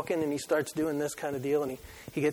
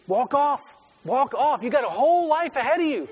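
raised, higher pleading call, laughing at the end; calling out to the field; 8 semitones higher, 5 dB louder than usual. Retold or imitated. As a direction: shout it across the field, half laughing. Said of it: "but I was saying".

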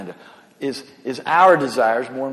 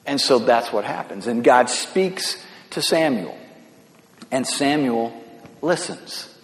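ordinary delivery

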